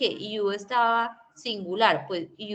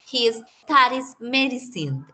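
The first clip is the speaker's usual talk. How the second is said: slow and a bit louder, teacher modelling; slow model sentence; 3 semitones higher, 5 dB louder, slower than usual. Shown as a demonstration. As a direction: lay out He studies medicine slowly. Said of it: "teacher models third person".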